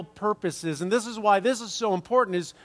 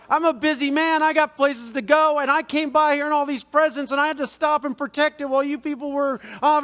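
much higher and louder, indignant scolding; indignant lecture, higher and louder, speeding up at the end; scolding stranger; 8 semitones higher, 3 dB louder than usual. Retold or imitated. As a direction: scold them like a busy man put out. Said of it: "he was angry".

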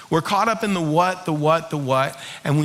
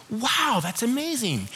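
high, soft, awed praise; amazed reaction; 4 semitones higher, 5 dB quieter than usual. Shown as a demonstration. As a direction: show hushed wonder at someone's idea. Models supportive reaction to an idea.

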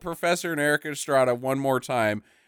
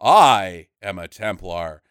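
mock deep Templar; 12 semitones lower than usual. Host mocking the Templar's reveal, low and pompous. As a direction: boom it out like a self-important knight.

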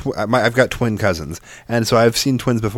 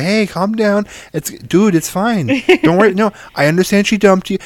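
high, sweet voice consoling a brother; baby sister reassuring her brother; soothing little sister voice; 11 semitones higher, 5 dB louder than usual. Retold or imitated. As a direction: pitch way up to play the baby girl calming her twin.

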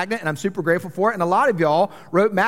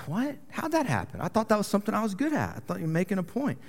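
quiet bewilderment; 3 semitones higher, 6 dB quieter than usual. Retold or imitated. Husband retelling his reply to the marriage counselor, quietly baffled. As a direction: act softly taken aback.

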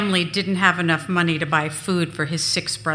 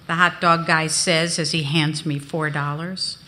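ordinary delivery, nothing marked

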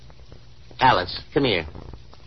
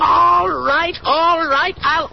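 loud and high, giving in furiously; angry shout; 10 semitones higher, 4 dB louder, much slower than usual. Acted. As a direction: throw it out, defeated and furious. Father gives in, shouting at wife.

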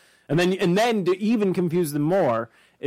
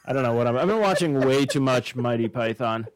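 usual speaking voice

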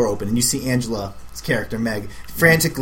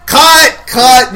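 sudden, much higher full-throated shout of cut; director yelling cut; 12 semitones higher, 13 dB louder than usual. Pretend. Director on set yelling at the actor to stop, loud shout. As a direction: bark it like a furious director stopping the take.

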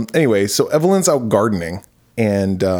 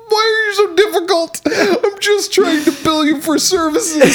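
real tears of laughter; crying with laughter. Real, not acted. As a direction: pretend exasperation drowned in laughter. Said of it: "I can't stop laughing".

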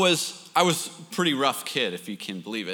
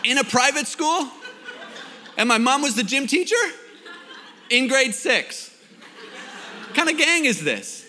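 high-pitched, mounting disbelief, played for laughs; comic incredulity; 7 semitones higher than usual. Accused of starting a gang in Oakville, comic incredulity. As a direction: question each absurd detail in mock outrage.

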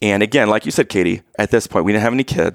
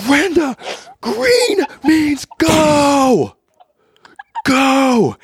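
yelling at wife; much slower than usual. Retold or imitated. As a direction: bellow it at the driver in pain. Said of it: "the only time I've ever yelled at your mom".

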